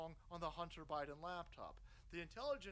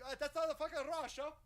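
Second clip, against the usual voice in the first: louder, higher mock Polish accent, hammed up; bad Polish accent; 9 semitones higher, 7 dB louder than usual. Host mocks the Poles denying it, bad Polish accent. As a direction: play a Pole scoffing in a bad Polish accent. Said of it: "It's a world's worst accent".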